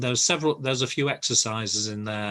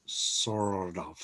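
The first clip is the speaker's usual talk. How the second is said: "sort of" in an American accent, the T flapped like "sord of"; 3 semitones lower, 5 dB quieter than usual. Shown as a demonstration. Teacher showing the American flap in "sort of". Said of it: "Now try to say it in an American accent".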